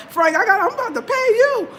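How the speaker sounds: high amused plea; laughing plea. Real, not acted. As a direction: laugh while begging Frank for help. Laughing appeal to Frank.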